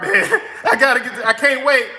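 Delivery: giggling through the words; excited chuckle breaking in; laughing. Real, not acted. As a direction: laugh while you say it. Laughing excitedly over card application.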